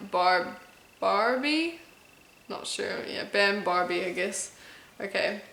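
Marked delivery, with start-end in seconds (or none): none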